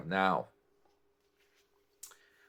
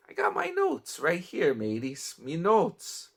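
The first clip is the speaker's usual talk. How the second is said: a playful pirate voice, pitched higher; mock pirate; 8 semitones higher than usual. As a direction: ham up a pirate captain greeting the crew. Joking pirate aside about notes.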